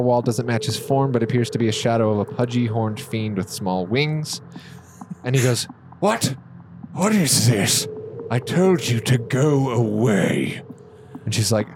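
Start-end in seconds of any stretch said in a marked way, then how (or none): 6.0-10.6 put-on startled old man. Overseer startled at intruders.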